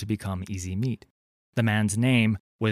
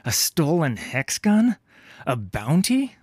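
rising, louder disbelief; raised, scornful questioning; incredulous; 6 semitones higher, 5 dB louder than usual. A narrator's reading. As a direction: fire each guess as an indignant question. Corsair incredulous at her motive.

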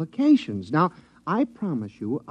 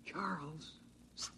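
urgent whisper to wake him; 2 semitones higher, 21 dB quieter than usual. Acted. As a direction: hiss his name softly so only he hears. George wakes dozing guard quietly.

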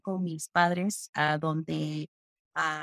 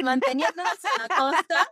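words bubbling with laughter, pitched higher; laughing; 10 semitones higher, 4 dB louder than usual. Real, not acted. Sharing a joke with her friend, laughing. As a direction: laugh through the punchline.

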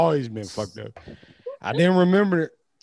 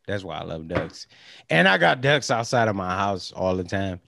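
ordinary talk, nothing marked

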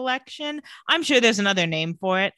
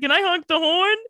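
higher, louder put-on kid voice; excited little boy; 8 semitones higher, 5 dB louder than usual. Imitating Matt asking to honk the horn, childishly excited. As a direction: beg like a child, in mockery.

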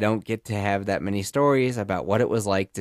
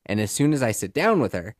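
ordinary talk, nothing marked

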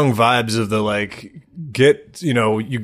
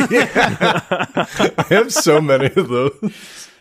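laughing; 8 semitones higher, slower than usual. Real, not acted.

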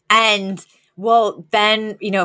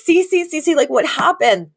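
urgent concern; 8 semitones higher than usual. Retold or imitated. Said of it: "and they're like".

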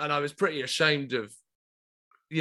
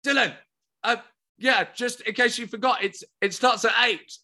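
high, eager calling out; over-excited; 5 semitones higher than usual. Retold or imitated. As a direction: call after him, too keen and raised in pitch. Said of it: "I am so excited".

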